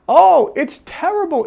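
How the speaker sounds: high, loud mock-dismay over "terrible"; amused lament. Billionaire laughing off the crisis, high mock lament. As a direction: lament the ruin while clearly amused. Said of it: "and he's laughing".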